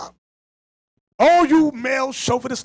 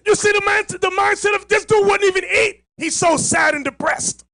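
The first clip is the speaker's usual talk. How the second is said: raised, higher preaching shout; 8 semitones higher than usual. Real, not acted. Preacher shouting to the congregation about Ahab, raised and higher.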